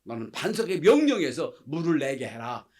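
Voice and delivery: loud, firm divine command; loud, firm order to Moses, with 명령해서 stressed; commanding God. Acted. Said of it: "그래서 하나님이 말씀하십니다".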